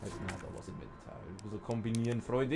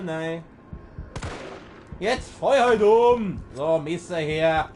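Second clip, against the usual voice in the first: loud, cocky trash talk; loud taunting; 3 semitones higher, 6 dB louder than usual. Real, not acted. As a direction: taunt the enemy loudly. Taunting enemy while fighting.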